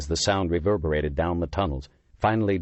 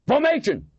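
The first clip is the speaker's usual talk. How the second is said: loud, high barked order; barked command; 4 dB louder than usual. A narrator's reading. Lieutenant barking orders at his men. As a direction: snap the men into line. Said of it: "Miller barked".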